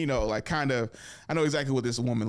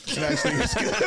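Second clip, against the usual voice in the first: breaks into laughter, pitched up high; laughing at his own story; 5 dB louder than usual. Real, not acted. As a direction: laugh through the words. Laughing at himself.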